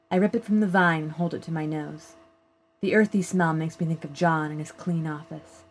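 ordinary delivery

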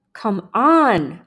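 loud, stretched, impatient urging. A narrator's reading. Another boy pushing his friend, urging. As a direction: urge him on, leaning on the last word. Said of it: "another voice urged".

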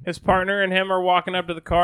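usual speaking voice

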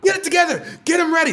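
high, loud showrunner's tirade; angry screaming. Retold or imitated. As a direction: imitate a boss screaming at staff. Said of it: "he's screaming at me".

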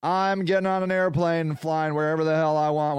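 normal talking voice

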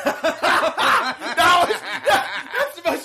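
high-pitched laughing through the words; cracking up mid-sentence. Real, not acted. Cohost laughs hard at his friend's slip. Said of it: "cohost cracks up at joke".

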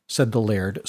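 plain reading voice

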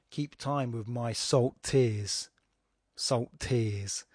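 slow line, SALT and TEARS held apart; gap between words. Shown as a demonstration. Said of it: "you have to leave that gap between salt and tears".

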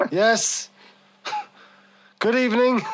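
forced greeting through clenched teeth; through gritted teeth. Pretend. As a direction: grit your teeth and force it out. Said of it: "I'm saying it through gritted teeth".

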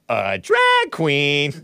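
slow drawn-out drag queen voice; sassy drag queen. Retold or imitated. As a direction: drag it out, diva style. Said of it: "sounds just like I'm meeting [name] himself".

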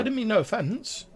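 high-pitched anxious sprite; Grimwald, squeaky. Acted. Timid sprite begs a lord's pardon, squeaky. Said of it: "in, like, a really, like, squeaky, high-pitched voice".

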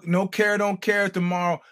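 ordinary delivery

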